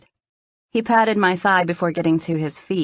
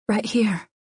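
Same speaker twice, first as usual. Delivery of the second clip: breathless; 4 semitones higher than usual. A narrator's reading. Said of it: "I said breathlessly".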